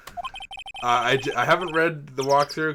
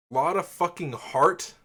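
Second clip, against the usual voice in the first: real incredulity; 2 semitones higher than usual. Real, not acted. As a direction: balk at it in disbelief, pitching up. Reacting to a silly character name.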